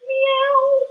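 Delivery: high, stretched-out kitty meow; playful high cat meow. Shown as a demonstration. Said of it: "and we can say meow".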